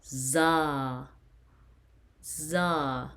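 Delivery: "za" with a held open ah; long A syllable. Shown as a demonstration. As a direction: model "za" slowly for pupils. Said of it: "you pronounce it as".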